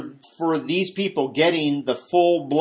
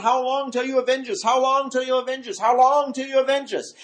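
crying out; 8 semitones higher than usual. Retold or imitated. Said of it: "they're crying out".

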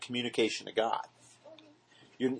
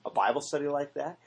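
ordinary delivery

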